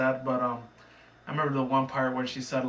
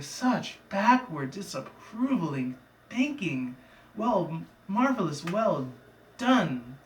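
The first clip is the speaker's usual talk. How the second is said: slow, higher, drawn-out leader voice; mock grand praise; 5 semitones higher, much slower than usual. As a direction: stretch each word in theatrical approval. Leader praising the converted pony.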